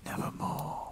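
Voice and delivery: soft, eerie whispered raven croak; the raven's answer. Acted. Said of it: "Quoth the raven".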